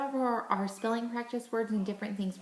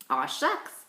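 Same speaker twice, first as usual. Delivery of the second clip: playful aw shucks; 6 semitones lower than usual. Shown as a demonstration. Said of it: "I want you to say".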